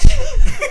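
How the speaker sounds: high, loud burst of laughter; real burst of giggles. Real, not acted. Laughing at own story.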